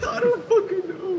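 frightened. Real, not acted. Scared after noise.

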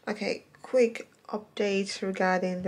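talking through metal brackets; speaking with braces. Real, not acted. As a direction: lips over braced teeth. Seen 2: Metal brackets and wires visible on teeth throughout, while speaking.

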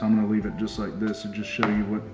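usual speaking voice

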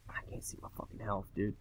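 very soft, voiceless grumble; hushed, quick muttered cursing; defeated whisper. Real, not acted. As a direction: whisper the complaint quickly to yourself. Muttering after being swarmed.

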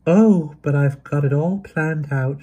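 high, slow and eager; bubbling with a girl's hope. A narrator's reading. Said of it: "she said eagerly".

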